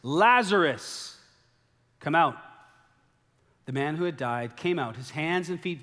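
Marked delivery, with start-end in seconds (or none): 0.0-2.4 1 loud call. A narrator's reading. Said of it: "he cried out with a loud voice".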